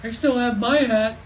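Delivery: storybook shout; bellowing. A narrator's reading. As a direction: bellow it over the wind. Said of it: "Joe bellowed".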